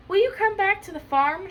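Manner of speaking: high, loud storybook question; lightly voiced pleading cow. A narrator's reading. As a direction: ask as Shirley the cow, lightly acted. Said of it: "Shirley asked".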